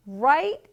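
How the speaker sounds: loud, rising "Right?"; "Right?" lifted upward as a question. Acted. Bunny checks his parents' directions, questioning. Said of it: "asked Buddy".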